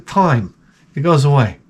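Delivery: normal talking voice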